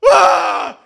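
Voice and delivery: loud, high imitated bawl; sudden hammed-up cry of overwhelm; mock weeping wail. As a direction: burst out in an exaggerated wail. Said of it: "starts weeping".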